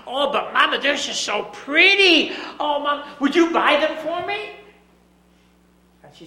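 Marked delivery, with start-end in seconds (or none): 0.1-4.6 put-on high, loud pleading child; little girl. Little girl begs her mother to buy the pearls, high and pleading. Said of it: "little girl pleads".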